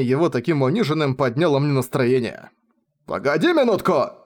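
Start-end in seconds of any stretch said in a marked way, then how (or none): 3.1-4.2 raised, urgent call; alarmed shout. A narrator's reading. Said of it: "прокричал Радок".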